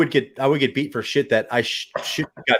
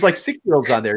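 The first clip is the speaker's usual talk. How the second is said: normal talking voice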